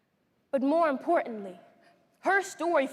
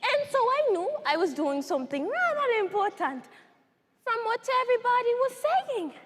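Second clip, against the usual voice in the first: higher, animated recollection; lightly voiced young survivor; 5 semitones higher than usual. Survivor recalling grandmother's house, lightly childlike and eager. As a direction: tell it with a child's eager innocence.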